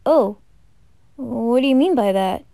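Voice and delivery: normal talking voice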